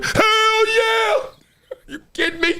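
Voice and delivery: high, shouted hell yeah; excited yell of agreement; eager shout. Retold or imitated. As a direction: jump at the chance. Imagined eager reply to Trump.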